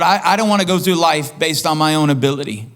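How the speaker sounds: usual speaking voice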